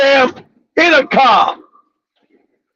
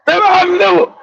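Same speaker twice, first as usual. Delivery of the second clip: normal talking voice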